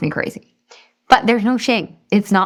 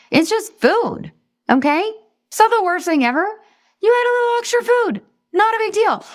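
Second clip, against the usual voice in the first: squeaky high reassurance. Real, not acted. Reassuring that regain is no shame.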